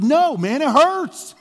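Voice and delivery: high, loud complaint, acted out; whining protest. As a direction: cry out in mock-pained protest. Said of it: "He whines".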